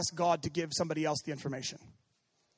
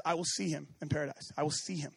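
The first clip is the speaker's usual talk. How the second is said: quiet faith; 5 semitones lower, 7 dB quieter than usual. Acted.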